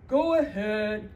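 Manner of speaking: grudging troll letting him pass. Acted. Troll letting the little goat pass, loud and gruff.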